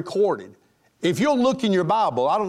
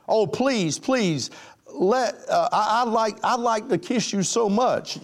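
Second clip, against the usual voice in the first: higher, mock-pleading suitor; 6 semitones higher than usual. Young man begs his date for a kiss, mock pleading. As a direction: beg in a hammed-up, stammering way.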